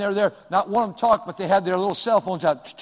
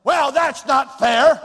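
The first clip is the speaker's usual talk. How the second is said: mock whining complaint; 5 semitones higher, 8 dB louder, slower than usual. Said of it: "But, but".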